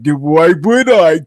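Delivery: slow, put-on dismissive drawl; mock dismissive dude. Imitating people who won't discuss politics, slow mock dismissal. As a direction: parody a guy who can't be bothered. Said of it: "people are like".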